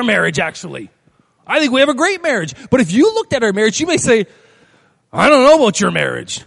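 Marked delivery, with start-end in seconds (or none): 5.1-6.4 louder, dubious retort in someone else's voice; doubting onlooker. Acted. Congregant judging the preacher's marriage, dubious. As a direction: voice a sceptical critic, loudly.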